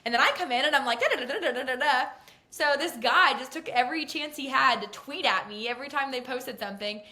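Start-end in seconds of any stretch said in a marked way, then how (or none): 1.0-2.0 mocking her own fast pit-reporter patter; fast chatter. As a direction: rattle it off like an auctioneer. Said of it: "and I can talk a little fast".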